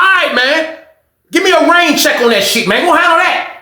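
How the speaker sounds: high-pitched pleading dismissal; hammed-up fed-up inmate; acted exasperated brush-off.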